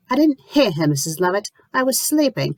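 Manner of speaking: high cold defiance; glaring. A narrator's reading. Lucy defying the landlady, high and glaring. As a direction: push back hard. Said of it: "Lucy glares".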